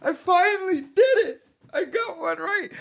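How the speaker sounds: hammed-up blubbering of a grown man; mock sobbing voice, high and strained; mock crying. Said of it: "broke down crying 'cause internally he's like".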